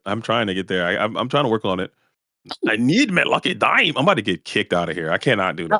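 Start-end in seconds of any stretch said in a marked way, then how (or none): 2.7-3.9 1 Scrooge McDuck impression. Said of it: "I can't do it".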